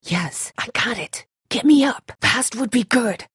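usual speaking voice

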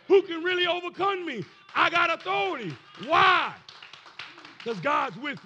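high, loud sermon shout; full-force preaching. Acted. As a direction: preach it in punchy, building bursts.

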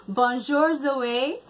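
"bonjour Zoe" said slowly and carefully in learner French. Real, not acted. Greeting a little French girl, slow careful learner French. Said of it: "So I'm gonna attempt this".

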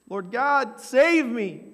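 high, loud plea for rescue; a panicked prayer shouted upward; desperate cry. Pretend. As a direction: cry out as if going under. Cry while sinking.

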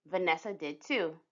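ordinary delivery

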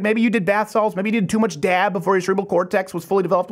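normal talking voice

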